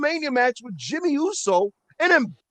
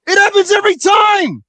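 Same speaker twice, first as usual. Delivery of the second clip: loud, higher exasperated outburst; exasperated shout; 7 semitones higher, 10 dB louder than usual. Real, not acted. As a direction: shout it out, fed up with the fans. Heated wrestling rant.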